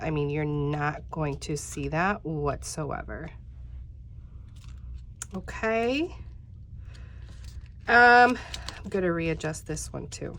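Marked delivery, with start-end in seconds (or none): none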